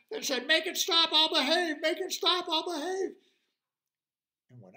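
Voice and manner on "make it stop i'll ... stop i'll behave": high, loud T-Rex wail; desperate pleading. Acted. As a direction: beg loudly for relief, pitched way up. T-Rex surrenders to the screaming.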